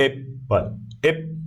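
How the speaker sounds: "एप्पल" broken into एप and पल with a gap; syllable by syllable. Shown as a demonstration. Teacher shows students the two syllables, "एप्पल" split apart. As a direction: say "एप्पल" in two separate beats. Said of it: "अलग हो गया, पल अलग हो गया".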